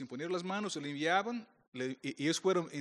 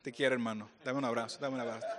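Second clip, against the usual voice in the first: lower, softer, teasing reconciliation; soft, lower, playful brotherly tenderness; mock tender; 7 semitones lower, 4 dB quieter than usual. Real, not acted. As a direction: coo it like a peace offering. Making peace with Argentine listener.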